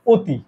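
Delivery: অতি said as "oti" with a closed o. Shown as a demonstration. Teacher shows students the real sound, closed vowel. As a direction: round the first vowel of অতি to "o". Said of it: "আমরা কি করি জানো?".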